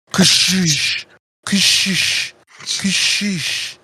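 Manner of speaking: repeated hissing "ka-shish"; sliding door noise. Acted. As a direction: imitate a door opening and closing. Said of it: "a door that keeps making a lot of noise".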